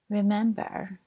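ordinary talk, nothing marked

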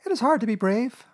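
small, high, slightly hurt voice; sniffly Piglet. A narrator's reading. Piglet excusing himself to Rabbit, a touch tearful. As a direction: plead timidly in a high little voice. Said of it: "sniffing slightly".

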